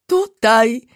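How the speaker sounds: high, loud giggly "Tutaj"; laughing reply. Acted. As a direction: tease from a hiding place. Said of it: "zaśmiał się".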